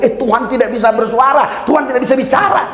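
high, quick quote of a naysayer; imitating someone's indignant objection; mock doubter. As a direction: pitch it way up and rattle it off like a scoffer. Mimics doubters he warns against.

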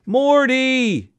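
high wailing call. A narrator's reading. Mother wailing her husband's name. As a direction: wail his name.

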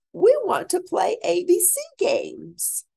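whiny pleading grown-ups. Acted.

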